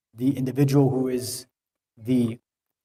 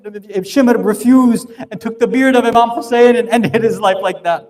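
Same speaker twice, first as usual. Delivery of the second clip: higher and louder, building to a full-force sermon cry; grieving preacher pushing each line higher and louder; impassioned preaching; 8 semitones higher, 7 dB louder than usual. Acted. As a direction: preach the martyrdom scene with rising urgency.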